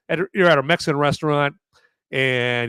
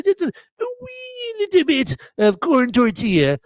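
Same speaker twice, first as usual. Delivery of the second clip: tiny voice; 13 semitones higher than usual. Acted. Playing down the corn taste.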